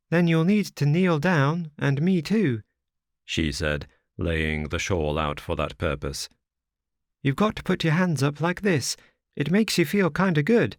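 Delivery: put-on high, childlike girl's voice; little girl. A narrator's reading. Little girl asks the wanderer if they will die, high childlike voice. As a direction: play a small frightened child asking.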